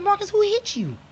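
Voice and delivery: high, loud alarmed cry; jolted by the handprint, asking fast; shocked question. Retold or imitated. Aunt to the slapped boy, shocked and high. Seeing handprint on child's face.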